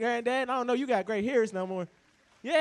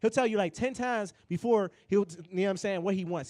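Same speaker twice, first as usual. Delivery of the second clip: ordinary talk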